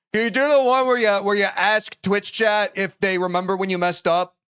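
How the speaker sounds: audience member. Pretend. Streamer voicing an audience member making a request. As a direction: call it out like an audience heckler. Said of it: "someone in the audience is like".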